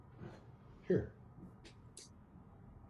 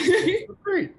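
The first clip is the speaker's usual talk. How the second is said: high, laughing delight; 6 dB louder than usual. Real, not acted. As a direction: laugh it out, overjoyed. Laughing, being thanked.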